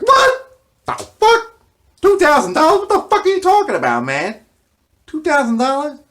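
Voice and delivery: what, two thousand, squeaked in outrage; shocked outburst. Retold or imitated. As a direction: blurt it out, stunned by the price. Shocked reaction to the price.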